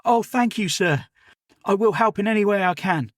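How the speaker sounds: put-on a keen boy apologising to his elder; eager servant boy Jim.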